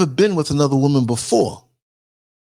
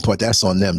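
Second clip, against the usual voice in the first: ordinary talk, nothing marked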